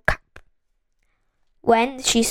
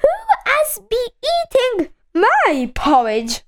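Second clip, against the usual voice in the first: higher-pitched, medium-sized bear voice; Mother Bear; 9 semitones higher than usual. A narrator's reading. Mother Bear asks who ate her porridge, medium-sized voice. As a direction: ask it high, at a middling volume. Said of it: "in a medium-sized voice".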